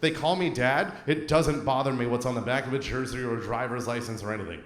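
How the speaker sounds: ordinary talk, nothing marked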